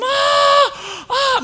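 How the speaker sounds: high, loud mock scream of terror; hammed-up shriek; imitated passenger scream. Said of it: "And they would scream".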